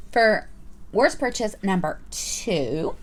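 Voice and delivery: ordinary delivery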